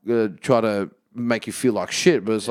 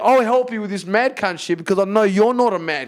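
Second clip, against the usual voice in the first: higher, louder, fast and fired up; fired-up rant; 9 semitones higher, 3 dB louder than usual. Real, not acted. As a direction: drive it home, getting worked up. Heated emphatic rant to co-host.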